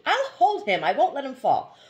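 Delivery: high, loud reassurance; insistent. A narrator's reading. Husband insisting to wife he'll hold the baby, high and loud. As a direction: push back eagerly on her fear.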